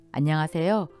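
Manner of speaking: ordinary talk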